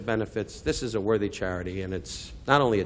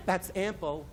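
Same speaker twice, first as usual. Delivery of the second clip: high, soft, put-on voice of a stage manager; prissy stage manager voice; 8 semitones higher, 8 dB quieter, slower than usual. Comic stage manager cutting him off, high and soft. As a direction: mince it like a fussy stage manager.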